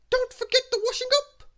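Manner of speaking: very high, loud bossy cry; high-pitched shouted reminder; Little Miss Bossy. A narrator's reading. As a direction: call out the order sharply. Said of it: "cried Little Miss Bossy".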